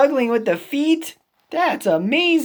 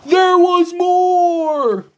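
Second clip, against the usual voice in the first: slow, loud, high there was more; dramatic reveal; 8 semitones higher, 4 dB louder, slower than usual. Acted. Gerald builds to his next story, slow and loud. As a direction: draw it out for suspense.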